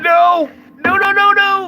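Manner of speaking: loud, high no no no; panicked cry of protest; alarmed shout. Real, not acted. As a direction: yell to stop it right now. Protesting hot water on skunk.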